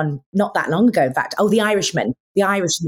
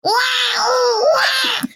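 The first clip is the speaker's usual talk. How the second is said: put-on baby cry; much slower than usual. Shown as a demonstration. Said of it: "I turned it into a baby cry".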